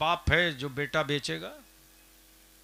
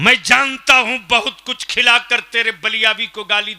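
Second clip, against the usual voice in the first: raised, forceful preaching shout; loud denunciation; 6 semitones higher, 10 dB louder than usual. Real, not acted. Preacher exposing people paid to abuse him, loud and high. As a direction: denounce your paid critics loudly. Denounces hired critics loudly.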